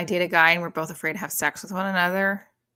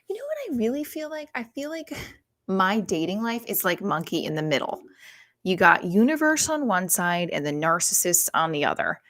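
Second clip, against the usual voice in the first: ordinary talk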